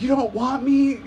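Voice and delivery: high-pitched tearful whine; mock crying. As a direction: imitate a drunk friend wailing over rejection. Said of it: "she starts crying".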